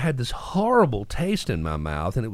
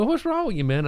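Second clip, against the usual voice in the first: puzzled buddies; 11 semitones higher than usual. Retold or imitated. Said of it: "stick their heads out of their tent and go".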